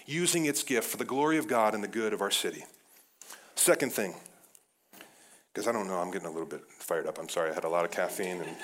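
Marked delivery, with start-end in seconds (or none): 5.5-8.6 breathless, rushed, laughing; fast and winded from excitement; out of breath. Real, not acted. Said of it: "I'm out of breath".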